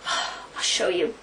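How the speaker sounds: breathy whispered tease, slow; a slow, secretive little whisper. Acted. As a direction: lean in and whisper it slowly. Teasing setup before the demo.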